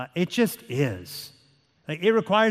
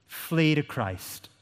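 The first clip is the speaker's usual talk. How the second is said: ordinary talk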